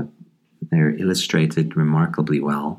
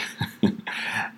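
quiet laugh; 7 dB quieter than usual. Real, not acted. Amused by the witch's reaction.

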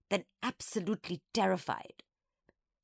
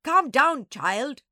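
loud, stern teacher's snap; sharp, impatient command; snapped; 7 semitones higher, 11 dB louder, much slower than usual. A narrator's reading. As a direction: bark an order at a hysterical child. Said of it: "the Doberman snapped".

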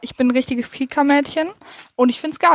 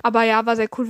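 usual speaking voice

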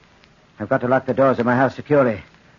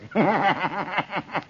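maniacal laugh; 4 semitones higher, 7 dB quieter than usual. Acted. Madman laughs about killing.

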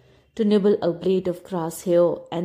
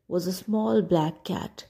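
ordinary delivery

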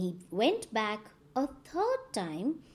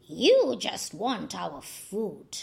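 lower, slow accusing crow voice; 4 semitones lower, slower than usual. A narrator's reading. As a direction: call out the lie, slowly. Crows accuse Anansi.

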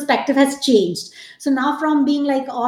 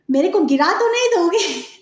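higher-pitched, hammed-up Tamilian accent; exaggerated Tamilian accent; 5 semitones higher than usual. Deepika teasing him on the climb, in a mock Tamilian accent. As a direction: imitate a really bad Tamilian accent, pitched up. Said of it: "like, really bad, um, exaggerated, uh, Tamilian accent".

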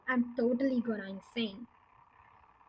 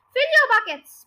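higher, louder command; ordered; 9 semitones higher, 10 dB louder than usual. Acted. Muggle Wump ordering the monkeys at the glue barrel, loud command. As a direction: give the order briskly. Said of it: "Muggle Wump ordered".

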